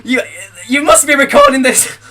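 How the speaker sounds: normal talking voice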